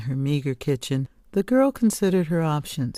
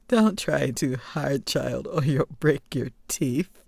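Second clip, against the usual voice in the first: wheezing from laughter. Acted. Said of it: "witch had fallen off her perch, wheezing".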